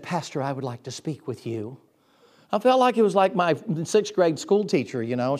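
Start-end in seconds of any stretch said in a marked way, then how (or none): 0.0-1.8 a hint of a prim, stern elderly lady, softer and lower; stiffly formal request, lightly voiced; prim old lady. Lady asks the pastor for a word, primly. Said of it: "she came up and she said".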